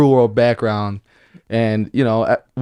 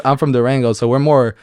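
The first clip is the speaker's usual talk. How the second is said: normal talking voice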